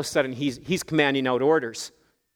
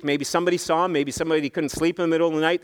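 usual speaking voice

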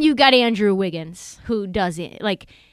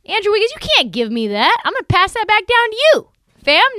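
worked-up rant; 9 semitones higher, 5 dB louder, faster than usual. Retold or imitated. Mock rant to a friend.

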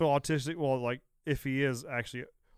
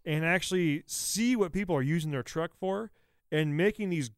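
ordinary talk

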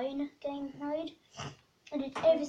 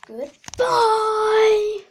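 loud high goodbye shout; 9 semitones higher, 14 dB louder than usual. Acted. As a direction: yell the goodbye. Shouted sign-off.

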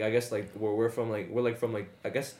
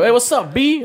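mock New York greeting, much higher and louder; imitating a New York guy; 10 semitones higher, 8 dB louder than usual. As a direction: hype up the greeting like a street dude from New York. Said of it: "He's like".

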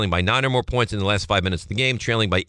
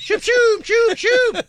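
loud, very high sound effect; high whoosh noises; 5 dB louder, much slower than usual. Shown as a demonstration. Father trying sound effects on his son, loud high whooshes. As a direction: shoot two piercing zooming noises out. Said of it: "Try sound effects".

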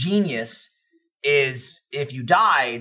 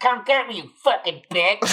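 mock taunting dare; 6 semitones higher than usual. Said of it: "And they're like".

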